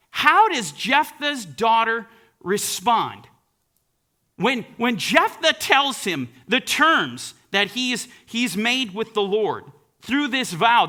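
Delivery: ordinary talk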